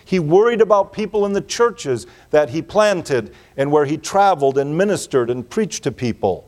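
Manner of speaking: ordinary delivery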